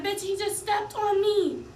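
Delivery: angry retort. Retold or imitated. Woman snapping back at the girlfriend, loud and angry. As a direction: snap back hard. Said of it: "I'm like".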